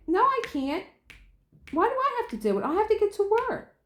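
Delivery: high, whiny mock complaint; high whiny husband. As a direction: whine like the husband dodging chores. Husband voiced in a quarrel.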